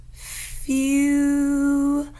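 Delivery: "Few" held with a long U; model word. Shown as a demonstration. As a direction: stretch the U in "Few". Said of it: "say the word few".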